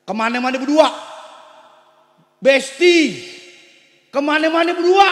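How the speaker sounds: high, loud preaching cry; preaching shout. Acted. Preacher driving home respect for aunts, shouting. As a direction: thunder it at the congregation.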